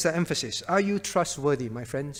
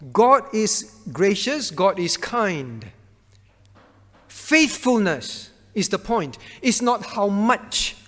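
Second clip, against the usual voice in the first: slow emphasis; 3 dB louder, slower than usual. Real, not acted. Slow emphatic teaching point.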